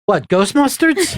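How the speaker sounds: incredulous echo; mock disbelief. Real, not acted. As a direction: query it as if baffled. Mock disbelief at the mispronounced line.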